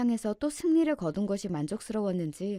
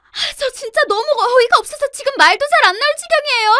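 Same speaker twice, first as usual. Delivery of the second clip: panicked, very high full-volume screaming; frantic high-pitched yelling to stop it; horrified shouting; 19 dB louder than usual. A narrator's reading. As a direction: scream in outraged denial, stammering at the start. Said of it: "경악에 찬 눈빛으로 이렇게 외치더군요".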